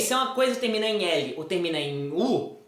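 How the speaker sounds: normal talking voice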